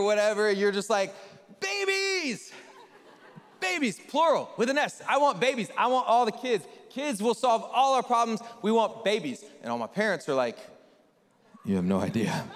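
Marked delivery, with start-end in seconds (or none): 1.6-9.4 high, gushing newlywed enthusiasm; hammed-up naive eagerness; mock baby fever. Mimicking newlyweds eager for babies. 11.6-12.4 very low, knowing reply; acted weary warning; jaded parent deadpan. Parents' jaded answer to newlyweds.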